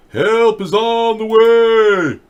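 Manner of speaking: high, loud campaign holler, stretched out; mock rally cry. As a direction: proclaim it like a hammed-up stump speech. Mocking candidate's slogan.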